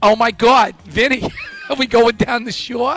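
mock Jersey Shore accent, drawn out. Radio host acting out a favorite accent, Jersey accent. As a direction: put on a Jersey accent, stretched and playful.

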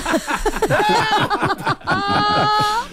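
high-pitched mock scream for help; drawn-out squealed cry, played for laughs; yelling toddler. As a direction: imitate a small child yelling, way up high. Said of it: "he'll just start yelling out".

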